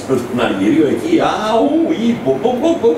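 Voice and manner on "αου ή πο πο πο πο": amazed exclamation. Retold or imitated. Imitating amazed reaction to delays.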